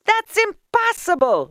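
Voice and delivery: very high crowd shout; high-pitched, loud exclamation. A narrator's reading. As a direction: shout disbelief from the houses. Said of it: "shouted the people".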